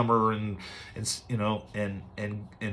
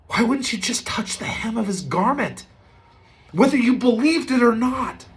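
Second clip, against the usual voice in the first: much higher, urgent pleading; pleading servant; 10 semitones higher than usual. Retold or imitated. Servant urging proud Naaman, high and pleading. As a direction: beg him to just try it.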